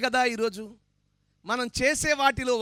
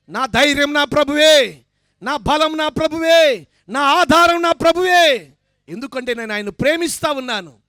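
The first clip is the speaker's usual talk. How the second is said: fiery revival preacher at full voice; preaching at full force; 5 semitones higher, 11 dB louder than usual. Acted. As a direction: proclaim it to the whole church, building to a shout. Preaching climax on the cross.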